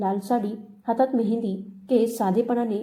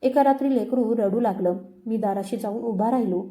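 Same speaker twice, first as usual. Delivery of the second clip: usual speaking voice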